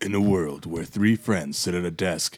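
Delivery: measured mock-epic trailer narrator; movie trailer voice. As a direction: read it out as a film trailer, slowing down. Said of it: "your movie voice".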